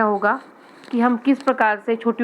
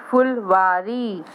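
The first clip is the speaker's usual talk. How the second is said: Phulwari drawn out slowly, syllable by syllable; slow sounding out; much slower than usual. Shown as a demonstration. Teacher to young pupils, saying Phulwari slowly. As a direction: stretch Phulwari long for the children. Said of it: "teacher sounds out word".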